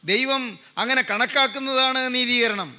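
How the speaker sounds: sustained, drawn-out preacher delivery; forceful preaching. Real, not acted. Preacher to congregation on being counted righteous, forceful preaching. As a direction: proclaim it with stretched, ringing phrases.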